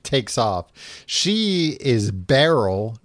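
normal talking voice

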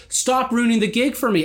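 high, aggrieved shot back; indignant retort. Retold or imitated. Drummer answering the complaint about his drumming, indignant.

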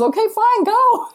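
high, mock-exasperated okay fine go; mock exasperated husband. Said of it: "He goes".

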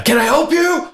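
loud, high-pitched challenge; confrontational shout. Shown as a demonstration. Homeowner confronting noise outside, loud high challenge. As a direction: yell it to startle a prowler. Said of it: "I go, like, every time".

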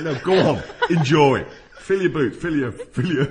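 loud, hammed-up coaxing; coaxing host. Feeders loudly coaxing the stuffed geese to eat.

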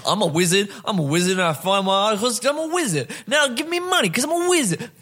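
high, fast mocking smug voice; mock a smug self-made wizard.

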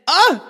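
startled yelp. Retold or imitated. Startled by bee at ear.

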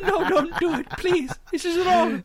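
high, pleading lady voice; hammed-up begging with laughter in it; mock terror. Passenger pleading with phone guy, mock terrorized. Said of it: "she's being terrorized".

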